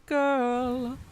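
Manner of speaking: ordinary delivery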